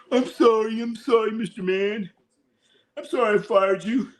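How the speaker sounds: put-on high whiny apology in a kid voice; mock crying boy. Mocking the owner apologizing to the fired GM, whiny. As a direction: ham up a sniveling kid's sorry. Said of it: "listened to this little boy cry".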